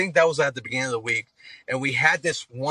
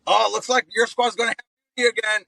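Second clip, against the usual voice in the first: fast, high sing-song gloat; mocking taunt; 8 semitones higher, faster than usual. Other squad gloats passing them: fast high mocking taunt. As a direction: rub it in with a higher, quicker taunt.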